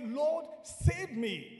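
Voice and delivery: loud, high, drawn-out plea; calling out. Shown as a demonstration. Preacher showing how to call on the Lord, crying out. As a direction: demonstrate crying out to God for rescue. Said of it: "Call out to the Lord".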